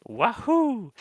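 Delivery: put-on high, loud whooping "wahoo" like an excited kid; big drawn-out imitation shout; imitated gleeful scream. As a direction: holler it out, mimicking a thrilled young moviegoer. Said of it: "screamed at the top of his lungs".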